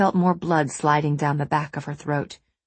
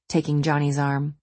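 ordinary delivery

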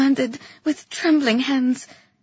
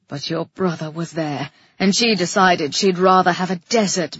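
low male character voice; deep-pitched, bitter recounting building to anger; bitter Calyx; 6 semitones lower, 3 dB louder than usual. A narrator's reading. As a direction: confess darkly, growing louder and more forceful.

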